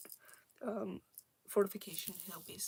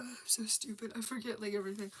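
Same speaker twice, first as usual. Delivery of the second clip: usual speaking voice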